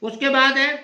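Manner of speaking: ordinary talk, nothing marked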